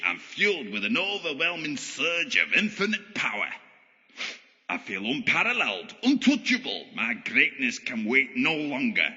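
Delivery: grandiose megalomaniac proclamation; pantomime supervillain. Acted. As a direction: declaim like a power-mad stage villain. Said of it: "pantomime supervillain act".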